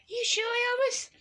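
very high, slow squeak; squeaky voice. Pretend. Kid voicing a mob asking [name], very high squeaky. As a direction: squeak it like a tiny cartoon helper.

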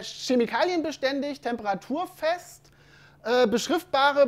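slow, deliberate listing of keywords; slow read-out. Shown as a demonstration. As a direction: read each bold word out slowly. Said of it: "Ich lese jetzt einfach nur mal das Fettgedruckte vor".

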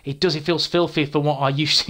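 normal talking voice

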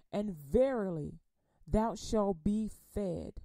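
solemn slow Psalm reading; slow scripture reading. Acted. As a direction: read each word slowly so it sinks in. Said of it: "I'm gonna read it slow".

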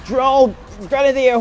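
urgent callout yell; calling out. Real, not acted. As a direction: bark the callout loud and high. Calling out to teammates.

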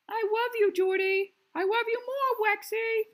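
puppet voices. Acted. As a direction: make the puppets coo at each other. Puppets declare love in squeaky voice.

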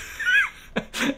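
loud, high burst of laughter. Real, not acted. Streamer laughing at own bad eBay sale, high laugh.

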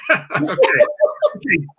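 okay through laughter; real laugh breaking into the words; amused, laughing. Real, not acted. Child walks in, speaker laughs.

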